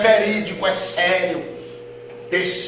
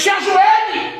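barked order; 7 dB louder, much slower than usual. Retold or imitated. Gang leader orders him to kneel.

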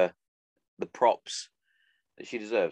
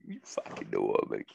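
lower, voiced take of the line; 11 semitones lower, 6 dB quieter than usual. Real, not acted. To his listeners, a low growled repeat. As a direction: drop your voice low and drag it.

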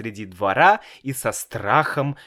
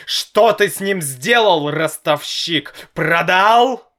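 angry berating; 6 semitones higher, 6 dB louder than usual. A narrator's reading. Father berates Senya.

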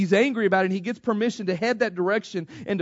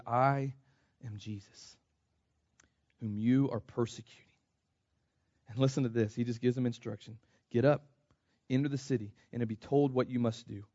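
much softer, hushed pleading Jesus; gentle voice of Jesus; 6 semitones lower, 8 dB quieter than usual. Acted. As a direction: let the Lord ask it softly. Preacher voices Jesus' reply.

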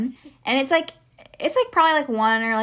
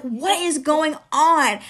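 slow, raised-pitch bewilderment; retold shock; 4 semitones higher, much slower than usual. Teller recalling the slammed door, baffled and shaken. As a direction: stretch the question out, stunned. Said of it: "I was, like, so shook".

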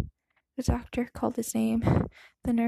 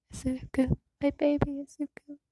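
sobbing; 4 semitones higher than usual. A narrator's reading. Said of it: "she sobbed between gasps of breath".

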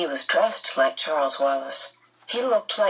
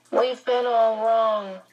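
put-on higher, slow, mechanical child voice; canned, recorded-sounding; 5 semitones higher, slower than usual. A narrator's reading. Possessed Charles tells Meg they were mistaken, canned-sounding. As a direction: recite it slowly, like a recording. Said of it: "There was a canned quality to it".

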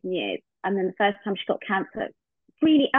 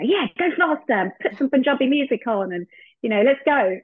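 excited cheering; 6 semitones higher, 4 dB louder than usual. Retold or imitated. Dying friend cheering the drive.

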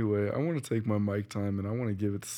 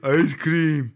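loud blurt; 5 semitones higher, 8 dB louder than usual. Acted. Mocking an impatient blurt.

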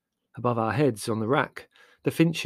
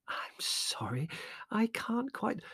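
soft whispered apology; hushed embarrassed Mother; 12 semitones higher, 8 dB quieter, slower than usual. A narrator's reading. Mother apologising to the customs man, hushed. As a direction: trail off in embarrassment.

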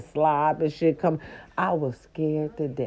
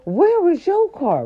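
high, panicked pleading; frantic high-pitched asking; 13 semitones higher, 3 dB louder than usual. Retold or imitated. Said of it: "I'm like".

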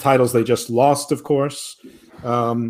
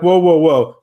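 loud mock interruption; 3 semitones higher, 7 dB louder than usual. Cody quoted interrupting Taz.

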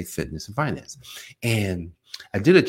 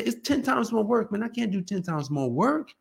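complaining body; 12 semitones higher than usual. Retold or imitated. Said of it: "The body's gonna say".

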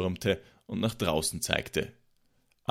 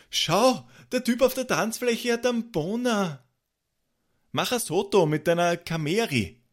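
high-pitched, sustained scandalized squeal; horrified shriek; 12 semitones higher, 3 dB louder than usual. A narrator's reading. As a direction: shriek it in horror, far above your usual pitch. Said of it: "schrie entsetzt".

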